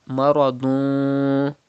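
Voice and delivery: maradun slow, the final n hidden and nasal; ikhfa ghunna. Shown as a demonstration. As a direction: slowly hum the tanween of maradun. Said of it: "You have to do gunna".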